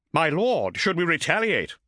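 tense question to the lord; anxious helmsman. Acted. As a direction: ask nervously for orders. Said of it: "asked helmsmate Sakharov".

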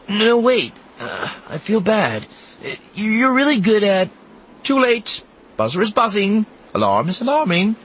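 put-on a kid scrambling to take it back; flustered boy. A narrator's reading. Temin protests, Sinjir cuts off.

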